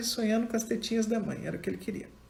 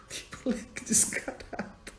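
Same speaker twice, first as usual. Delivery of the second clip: laughing whisper; 3 semitones higher, 3 dB quieter than usual. Real, not acted. Amused remark on cheeky boy.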